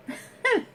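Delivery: real high giggle. Real, not acted. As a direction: let the giggle fade out. Laughing at the sponge.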